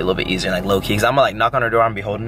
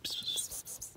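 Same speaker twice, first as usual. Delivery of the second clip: whisper; 22 dB quieter than usual. Real, not acted. Calling kitten softly.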